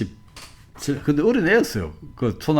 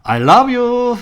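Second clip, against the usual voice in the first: very high, loud, laughing call of love; mother's cheerful reply; 14 semitones higher, 8 dB louder, much slower than usual. Retold or imitated. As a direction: answer the tantrum with a beaming, laughing call. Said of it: "엄마가 대답이 뭔지 알아요".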